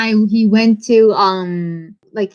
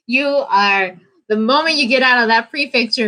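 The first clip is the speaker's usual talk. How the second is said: ordinary talk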